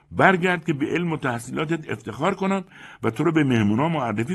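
high, loud urgent begging; don't let him leave; desperate fawning insistence; insistent pleading. Acted. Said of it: "با اصرار گفت".

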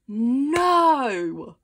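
loud, long-drawn no; disbelieving cry. Real, not acted. Vlogger seeing the last card, stunned disbelief. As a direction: wail it out in happy disbelief.

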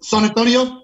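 lightly put-on Mexican accent on San Antonio; Spanish accent. Shown as a demonstration. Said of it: "Spanish or Mexican out, uh, accent".

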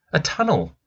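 put-on a boy pulling his sister toward the tunnel, eager. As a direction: urge her to come and see. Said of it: "he said".

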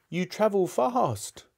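wondering Scrooge. Acted. Scrooge remarking on the ghost's speed, high and wondering. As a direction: draw it out in a high character voice.

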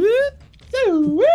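high rising Suwoo call. Pretend. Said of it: "you were doing Suwoo".

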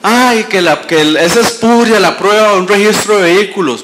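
high, loud imitation of whining objectors; mock complaint. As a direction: parody an overwrought legal objection. Mocking lawyers' usual complaint.

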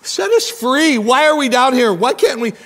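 high, loud mock whine; whining to God. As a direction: cry out like put-upon complainers. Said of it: "they would whine to God".

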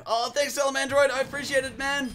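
high, upbeat thank-you; delighted thanks. Real, not acted. Thanking a viewer in the chat.

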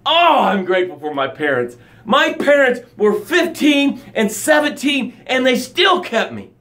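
high, energized thanks; fired-up grateful testimony; passionate gratitude. Shown as a demonstration. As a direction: pour the same passion into gratitude. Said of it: "use that same energy and same passion".

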